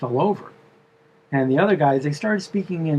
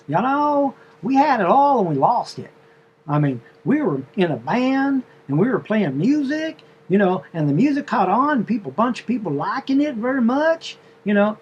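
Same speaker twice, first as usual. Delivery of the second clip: higher, chatty southern drawl; 5 semitones higher than usual. Retold or imitated. The wife telling the driver her story in a southern drawl. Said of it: "a southern drawl or whatever".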